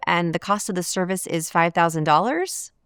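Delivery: "five thousand dollars" rising like a question; unsure upturn on "five thousand dollars"; question inflection. Shown as a demonstration. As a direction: state the price as if asking, "dollars" swung up. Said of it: "asks a number they're unsure of".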